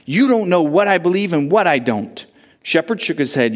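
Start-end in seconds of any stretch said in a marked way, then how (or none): 0.0-2.2 put-on defiant boy. A narrator's reading. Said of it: "Johnson said".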